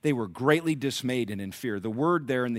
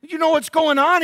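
high, loud, disbelieving; can you believe this guy; incredulous; 14 semitones higher, 5 dB louder than usual. Retold or imitated. Soldiers of Israel telling David about Goliath, high incredulous exclaim. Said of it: "the men of Israel said".

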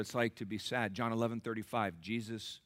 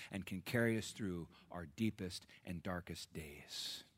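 softer whispered comfort; 4 semitones lower, 7 dB quieter than usual. Acted. Pastor hushing to comfort his church, whispered. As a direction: drop to a hush to console. Sermon dropping to intimate close.

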